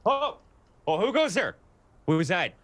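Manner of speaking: high, loud, startled; startled gruff guard. Acted. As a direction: shout up high in alarm. Startled guard confronts orc.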